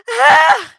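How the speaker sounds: short, loud scream. A narrator's reading. Cassie screams as the creature grabs her, loud short scream. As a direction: burst out with a sudden acted scream. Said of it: "voice just screamed".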